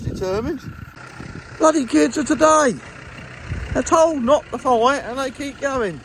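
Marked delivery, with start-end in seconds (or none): 1.6-6.0 put-on loud high grumbling at kids; exasperated parent. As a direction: scold them like bloody kids. Scolding cows like children.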